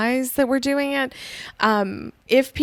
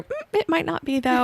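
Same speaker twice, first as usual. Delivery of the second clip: higher, lightly mocked doubting brain; skeptical inner voice; 9 semitones higher than usual. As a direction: imitate the brain hedging, pitched up. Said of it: "your brain's gonna go".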